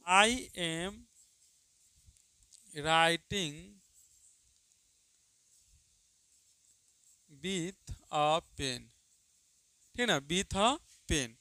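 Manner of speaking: word by word, with long gaps; slow dictation. Shown as a demonstration. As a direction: read it out so students can write. Dictates a sentence slowly. (धीमे-धीमे बोलकर लिखवाना)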